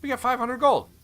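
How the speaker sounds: high, loud cheer over the loot; excited. Real, not acted. Player cheering at the gold reward, excited.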